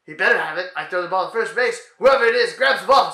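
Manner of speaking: ordinary talk, nothing marked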